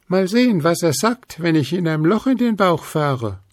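a sudden bright idea; Luzi. A narrator's reading. Luzi chimes in with a plan, high. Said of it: "Das Wichtelfräulein".

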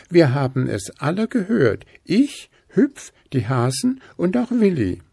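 Stachelchen contradicting. A narrator's reading. Stachelchen contradicts Gruseli, high and bristly. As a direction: bristle back at him. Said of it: "widersprach Stachelchen".

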